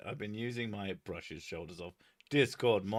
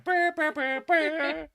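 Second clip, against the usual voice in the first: high loud trumpet imitation; hummed trumpet fanfare; 7 dB louder, slower than usual. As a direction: blare the theme tune. John Cena theme trumpet.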